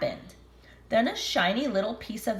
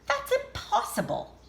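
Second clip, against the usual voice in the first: grandma voice. Acted. Grandma's shocked thought.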